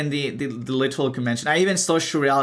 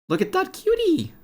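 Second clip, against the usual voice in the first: cutesy squeal. Real, not acted. Coos over cute sticker.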